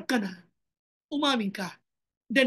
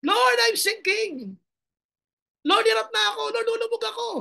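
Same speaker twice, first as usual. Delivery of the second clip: desperate cry; 10 semitones higher, 4 dB louder than usual. Acted. Preacher showing how to cry out to the Lord. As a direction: cry out as you go under. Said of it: "Lapit ka kay Lord".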